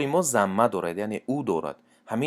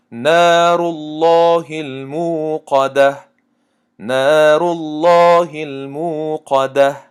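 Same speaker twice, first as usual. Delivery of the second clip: slow, drawn-out chanted recitation, higher and louder; Quran recitation; 5 semitones higher, 7 dB louder, much slower than usual. Shown as a demonstration. Teacher chanting verses for students, slow melodic recitation.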